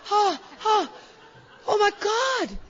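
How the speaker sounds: very high, loud mock wail; acted horror. Mother as everybody's crying, hammed-up high wail.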